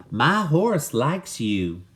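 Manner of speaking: put-on a friendly cowgirl greeting the boy, slow. Cowgirl's first line to Walter.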